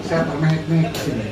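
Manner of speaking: usual speaking voice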